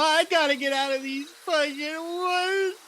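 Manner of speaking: high, slow crying plea; sobbing to escape the woods. Acted. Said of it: "really scared that you're lost in the woods".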